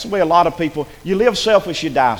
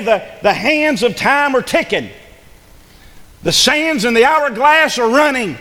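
urgent pulpit crescendo; fiery preaching; 6 semitones higher than usual. Acted. Preaching climax.

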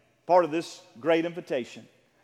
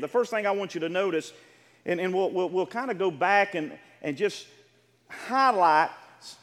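normal talking voice

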